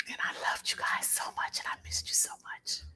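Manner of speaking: choked up, barely a whisper; real tearful hush. Real, not acted. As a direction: hold back tears in a whisper. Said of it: "I'm gonna stop crying".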